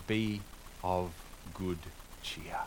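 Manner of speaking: put-on quiet, word by word, old translation read out; softly spaced old-fashioned quotation; slow quoted reading. Shown as a demonstration. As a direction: read out the old wording, savouring it. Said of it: "as one of the older translations put it".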